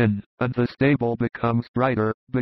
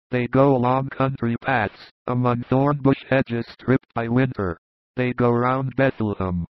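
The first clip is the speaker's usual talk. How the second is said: plain reading voice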